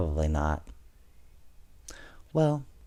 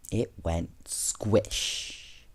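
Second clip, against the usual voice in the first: squishy sound word; 3 semitones lower, 8 dB quieter, much slower than usual. A narrator's reading.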